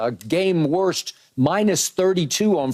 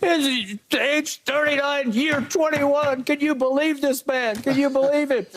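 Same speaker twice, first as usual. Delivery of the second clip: mock hype, pitched way up; Reggie Miller hype; 8 semitones higher than usual. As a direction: gush like an overexcited announcer. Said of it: "Reggie just goes crazy".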